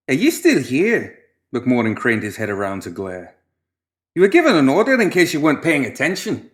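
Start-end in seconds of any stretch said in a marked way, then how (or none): 0.1-1.0 high, loud, bossy mad-doctor voice; pompous doctor. Acted. 4.2-6.5 high, loud, bossy mad-doctor voice; pompous doctor. Acted.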